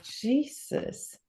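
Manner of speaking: breathy whispered "Jesus" under the breath; whispered exclamation. Retold or imitated. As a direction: whisper it in disbelief. Reacting to friend's quick deck.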